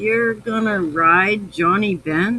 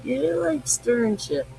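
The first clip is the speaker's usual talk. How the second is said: imitating Roy; 6 semitones higher, 4 dB louder than usual. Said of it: "Roy says".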